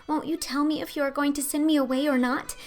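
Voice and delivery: put-on high, loud pleading girl voice; imploring Anne. A narrator's reading. Little orphan girl pleading with Marilla, imploring. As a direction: beg her not to send you away. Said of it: "said in an imploring voice".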